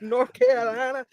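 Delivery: high-pitched wrestler promo impression; name home states like a promo; Dax. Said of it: "I can hear it in my Dax voice".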